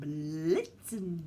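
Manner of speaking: "Blitzen" with a lengthened L, pitched low; tongue-rolled Blitzen. Shown as a demonstration. Children are shown the tongue sound in "Blitzen", long L. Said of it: "have to do that with your tongue".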